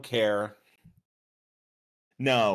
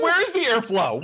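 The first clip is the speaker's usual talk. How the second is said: usual speaking voice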